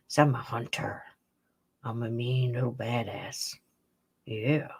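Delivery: low, soft tough-critter voice; voicing the cat. Speaking as the cat boasting about catching mousies, low tough voice. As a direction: drop the pitch and play a mean little hunter. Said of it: "You tell everybody you got some mousies".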